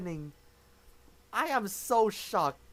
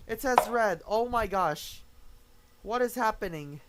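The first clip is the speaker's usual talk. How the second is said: usual speaking voice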